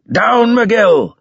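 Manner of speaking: high, loud battlefield shout; screamed warning. A narrator's reading. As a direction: yell for a comrade to hit the dirt. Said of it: "he screamed".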